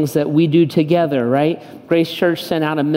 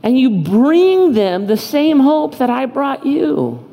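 preaching at full force; 7 semitones higher than usual. Acted. Pastor voicing God's charge to the congregation, impassioned preaching. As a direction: plead with them as God at full force.

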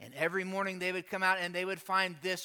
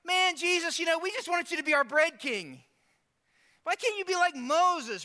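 high, mock-frustrated pleading; 9 semitones higher, 4 dB louder than usual. Preacher plays the crowd pleading with Jesus, frustrated. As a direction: protest loudly in a raised, exasperated voice. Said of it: "These guys get frustrated".

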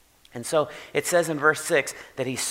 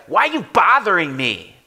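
loud, higher annoyed yell; demon yelling; 5 semitones higher, 8 dB louder than usual. Retold or imitated. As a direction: snap it at Jesus like a cornered demon. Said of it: "we may say, say something like this".

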